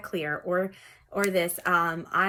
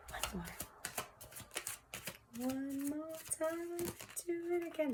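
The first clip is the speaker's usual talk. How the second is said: high, soft, drawn-out singsong; coaxing singsong; 10 semitones higher, 12 dB quieter than usual. Pretend. Tarot reader asking Spirit for another card, soft high singsong. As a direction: coax softly in a high voice. Coaxes the cards while shuffling.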